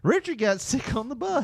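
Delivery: high mocking kid voice. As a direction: tease it like a schoolkid. Kids gossip voiced.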